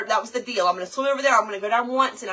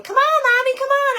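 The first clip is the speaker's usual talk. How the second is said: put-on high, loud little-boy call; imitating little Finn; 8 dB louder than usual. Little son calling his mom to hurry. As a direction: call out like an eager child. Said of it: "Finn's going".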